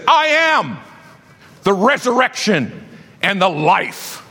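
a high, ringing shout on I am; proclaimed shout. Acted. Preacher voicing Jesus to the congregation, shouting. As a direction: thunder it from the pulpit.

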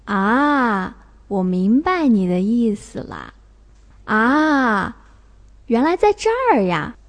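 long, drawn-out dipping "ah" of understanding; sudden realization. Shown as a demonstration. Teacher giving third-tone examples, realization. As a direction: let it dawn on you. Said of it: "明白了， 恍然大悟".

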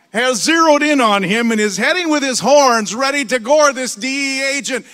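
ordinary talk, nothing marked

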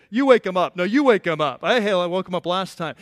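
loud, testy squabble imitating irritated men; acted-out peevish argument, punched loud; irritated bickering. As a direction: pass the chore on, loudly and crossly. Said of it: "They are irritated".

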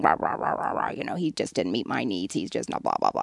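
low, soft mock grumble to oneself; grumbling. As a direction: imitate grousing under the breath, voice dropped way down. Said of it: "you know, grumbling".

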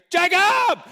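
high, full-out shout; teacher's hallway yell. Retold or imitated. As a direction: holler the name down a packed hallway. Teacher yells down packed hallway.

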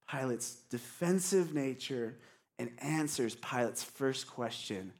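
normal talking voice

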